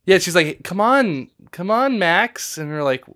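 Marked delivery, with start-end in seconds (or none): 0.6-2.6 higher-pitched, drawn-out coaxing call; mock beckoning. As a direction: imitate a girl urging someone along. Imitating vision daughter beckoning Max.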